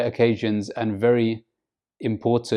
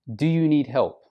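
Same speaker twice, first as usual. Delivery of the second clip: "Do you need help?" pitched up high; raised intonation; 4 semitones higher than usual. Shown as a demonstration. Teacher shows how to offer help, raised intonation. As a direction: lift "Do you need help?" to show interest. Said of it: "raise your intonation when you say that word".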